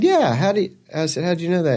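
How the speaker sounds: high-pitched, retold astonishment; surprised questioning. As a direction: ask it as if caught off guard. Surprised reply to being recognized.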